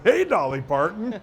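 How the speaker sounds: HEY swooping up high, then "Dolly Parton" dropping back; catchphrase inflection. Acted. Man retelling catching himself after a shower, "Hey" swooped up high. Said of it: "that's an inflection thing that I like".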